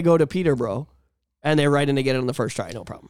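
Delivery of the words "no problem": ordinary talk, nothing marked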